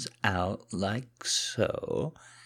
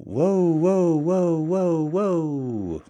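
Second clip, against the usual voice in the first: high, loud run of whoas; panicked, drawn-out stop-that cry; alarmed protest; 11 semitones higher, 9 dB louder, much slower than usual. Acted. Man being cuffed by his partner, alarmed.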